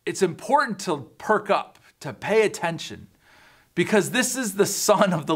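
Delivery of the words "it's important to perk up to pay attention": ordinary delivery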